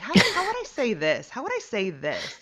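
imitating a pushy woman, high and loud at first; mock-aggressive question, easing off on the repeat. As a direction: play a forceful person demanding the words. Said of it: "very kind of, like, aggressive person".

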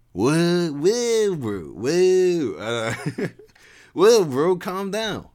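cool him down like a chill surfer dude; chiding surfer dude. Acted. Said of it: "Levi chided".

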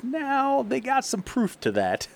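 high, drawn-up mock concession; comic punchline. Host retells comedian's devil joke, comic punchline. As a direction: lift the voice high to sell the retold joke.

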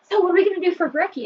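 imitating husband. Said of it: "he was like".

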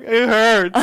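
high, loud mock whimper; whiny friend. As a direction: whine it like a hurt child. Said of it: "He's like".

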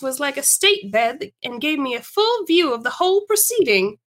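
animated story reading with big pitch swings; lively, theatrical narration; [name]'s storytelling. Acted. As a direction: relish the tale for an audience of friends.

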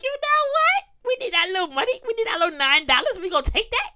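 high, sly voice of a sneaky company; a company whining that it wants your nine dollars; mock company voice.

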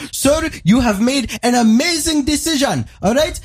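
very high, slow, hammed-up Indian accent; pushy Indian salesman. Salesman pushes the free Samsung, in a mock Indian accent. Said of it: "And then he goes".

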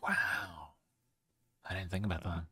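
soft, flat mock awe; deadpan. Real, not acted. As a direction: drop it quietly, stunned. Deadpan reaction to dark joke.

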